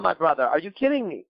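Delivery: ordinary talk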